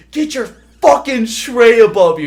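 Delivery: loud, high barked order; drill sergeant yell. Retold or imitated. Drill sergeant yelling at a recruit across the mess hall. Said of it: "He's like".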